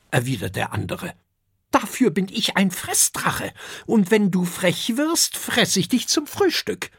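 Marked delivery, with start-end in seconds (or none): 1.7-6.9 put-on high, snappy young dragon; young eating dragon snapping at the intruder; hissing back. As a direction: spit it out defiantly. Dragon threatens the other.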